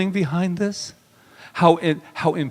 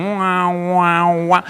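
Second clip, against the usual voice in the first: drawn-out, whiny "wah, wah"; mock wailing; 2 semitones higher, much slower than usual. Speaker mocking his own excuse about the teacher, mock wailing. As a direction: imitate a baby's wail.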